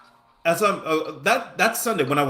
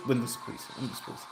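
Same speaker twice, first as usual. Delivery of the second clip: soft, lower repeated plea, fading away; quiet pleading; 4 semitones lower, 12 dB quieter, faster than usual. Real, not acted. Player willing the game to go their way, quiet pleading. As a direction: plead under your breath.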